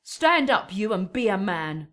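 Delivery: loud, high shout to rouse a man from the floor; scolding shout. Acted. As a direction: bark him to his feet, slowing on the last words. Nurse scolds Romeo on the floor.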